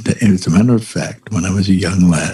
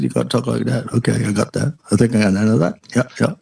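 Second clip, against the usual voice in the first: Australian accent through a closed mouth; lips-shut Aussie mumble; faster than usual. Shown as a demonstration. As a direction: mumble it Aussie without moving your lips. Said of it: "You talk without moving your lips".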